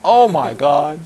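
hammed-up exasperation; dramatic dismay. Teacher imitating his master's dramatic despair over students. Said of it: "He does this dramatic".

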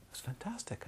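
soft, high half-whisper; quietly thrilled, barely voiced; hushed delight. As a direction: marvel under your breath.

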